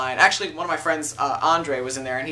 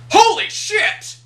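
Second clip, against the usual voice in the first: much higher and louder, slow shocked yell; loud exclamation; 8 dB louder, slower than usual. Pretend. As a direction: blurt it out loud for the whole room. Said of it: "it's one thing if I was like".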